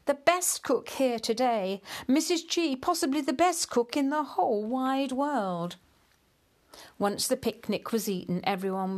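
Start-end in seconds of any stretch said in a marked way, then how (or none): 0.0-5.7 delighted praise. Acted. Praising wife's cooking.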